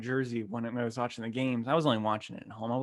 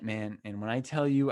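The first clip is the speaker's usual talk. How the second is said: normal talking voice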